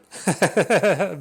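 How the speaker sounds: a short loud high laugh; chuckling at the comedy; burst of laughter. Real, not acted. Laughs about degenerate mentors.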